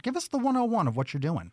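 usual speaking voice

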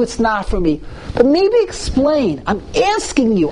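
high, pleading voice of a defiant son; insistent teen pushing his father; Rasha child's plea. Acted. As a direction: play the rebellious son demanding an answer.

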